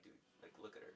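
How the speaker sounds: put-on whispered aside about a girl; a friend whispering in a crowded sauna; hushed whisper. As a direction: breathe it so she can't hear.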